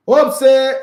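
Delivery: sudden loud high exclamation; loud outburst. Real, not acted. Commentator reacting to the red card, loud sudden outcry.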